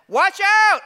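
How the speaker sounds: high, loud sudden shout; yelled warning. Retold or imitated. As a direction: shout an alarm at the whole room. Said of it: "He kinda yells like".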